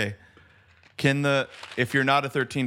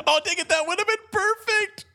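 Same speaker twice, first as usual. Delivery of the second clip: high frustrated squeal; excited squeal; 3 dB louder than usual. Real, not acted. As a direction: yelp it out, way up high. Missed Coors joke, high yelp.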